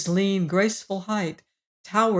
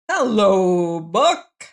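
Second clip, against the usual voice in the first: hearty, louder greeting called out; jovial call; 6 dB louder, much slower than usual. A narrator's reading. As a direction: call out a cheery hello. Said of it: "he called jovially".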